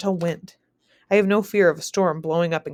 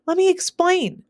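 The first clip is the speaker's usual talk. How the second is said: desperate shout, pitched much higher; 12 semitones higher, 4 dB louder than usual. Acted. Narrator pleading with raging Anne, shouting. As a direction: yell over her screaming. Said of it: "I shout".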